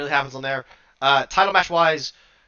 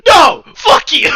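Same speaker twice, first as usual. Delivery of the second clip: loud, high imitation of an angry refusal; mad wrestler's outburst; 10 semitones higher, 13 dB louder, slower than usual. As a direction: shout it like a furious sore loser, hammed-up. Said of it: "And he gets so mad".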